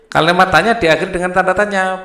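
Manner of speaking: the rule called out loud and fast; emphatic rule. Shown as a demonstration. Teacher drilling the class on the rule, loud and fast.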